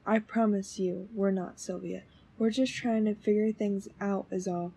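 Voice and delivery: soft, low, soothing voice; hushed, low-pitched pleading; gentle reassurance. Acted. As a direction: quietly reassure and coax, keeping the voice down. Reassuring an upset witness.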